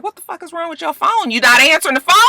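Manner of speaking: high, sharp mock confrontation; imitating an irate woman; angry demand. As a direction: demand it like a mad friend. Angry woman confronting her friend.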